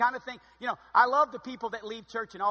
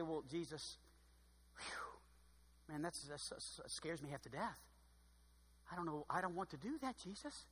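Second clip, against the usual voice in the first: soft, hushed, lower voice; frightened prayer; 4 semitones lower, 17 dB quieter than usual. Pretend. Believer answering Jesus in fear, hushed and soft. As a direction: whisper it as if scared to say it aloud.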